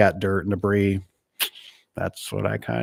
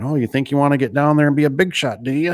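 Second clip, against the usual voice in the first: higher, louder sarcastic taunt; self-mocking taunt; 7 semitones higher, 4 dB louder than usual. Installer taunting himself about working on big doors, sarcastic. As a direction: tease yourself like a smug bully.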